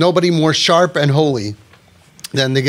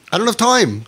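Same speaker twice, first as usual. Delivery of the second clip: I don't have time pitched higher, pushing it; insistent repeat; 6 semitones higher than usual. Pretend. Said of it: "We would feel like".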